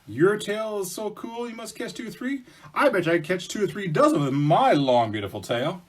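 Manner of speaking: high voice, building to a loud boast; bragging bear. Acted. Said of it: "says Bear".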